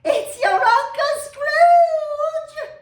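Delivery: very high, slow, sung-out laughing cry; laughing shriek. A narrator's reading. Plump sister revealing the answer, laughing and shrieking.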